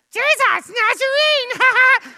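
very high, loud, drawn-out demon squeal; friend's demonic voice. Shown as a demonstration. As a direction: screech it like a comic little demon. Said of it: "much more likely something like this".

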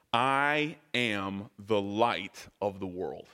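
"I am the light of the world" slow, weighty "I am"; stress on I am. Shown as a demonstration. Pastor setting out Jesus' words for the congregation, weighty and slow. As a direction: lean slowly into "I am". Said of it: "I am. Let's start there".

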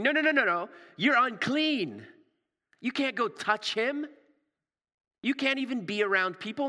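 high, urgent protest; alarmed objection. Acted. Crowd's alarmed objection acted out.

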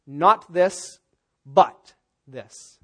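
"Not" and "but" both hit loud, split by a pause; strong contrast. Shown as a demonstration. Preacher demonstrating the contrast word, loud stress on "but". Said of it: "strongest possible word for a contrast".

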